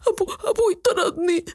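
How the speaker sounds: put-on a little boy sobbing that his father threw him out; crying boy. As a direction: cry out the words through tears. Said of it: "وعم ببكي".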